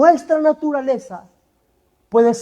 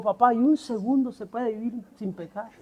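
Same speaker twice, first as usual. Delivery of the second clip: put-on very sad child; 3 semitones lower, 11 dB quieter than usual. A narrator's reading. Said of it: "Y el hijo muy triste dijo".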